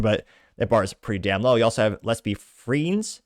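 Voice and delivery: usual speaking voice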